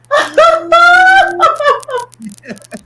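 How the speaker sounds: high, loud shrieking laugh; screaming with laughter. Real, not acted. Laughing at Maria's rap.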